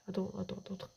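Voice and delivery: hushed, breathy repeats; whisper. Real, not acted. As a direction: whisper it to yourself. Hushing himself mid-game. (chuchoté)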